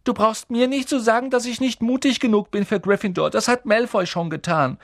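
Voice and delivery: put-on high, upset boy's voice; sobbing. A narrator's reading. Hurt boy answering friends about his lack of courage, tearful. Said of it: "schluchzte er".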